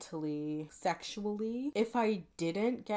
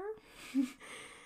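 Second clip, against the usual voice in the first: laugh; 12 semitones higher than usual. Real, not acted. Laughing at her own joke.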